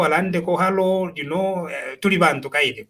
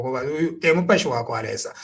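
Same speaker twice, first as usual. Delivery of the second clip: ordinary talk, nothing marked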